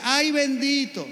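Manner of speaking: high, slow pitying ay, bendito; imitating the lady's pitying sigh; mock pity. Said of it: "aquella señora decía".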